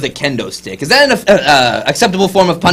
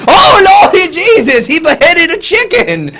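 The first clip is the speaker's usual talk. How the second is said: high-pitched hammed-up horror; theatrical, high panicked exclamation; mock superstitious alarm; 9 semitones higher than usual. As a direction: cry out like a scandalized believer. Acts out a superstitious onlooker's alarm.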